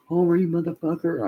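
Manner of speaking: high, loud exasperated yell; mock angry holler. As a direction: holler after a runaway dog. Acted yelling while chasing dog.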